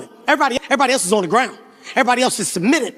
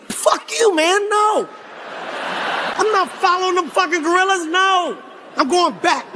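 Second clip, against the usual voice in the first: high, hot refusal shouted back; angry refusal; 5 semitones higher than usual. Retold or imitated. Kevin refusing the guide, high angry shout. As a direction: snap back, done with the trek.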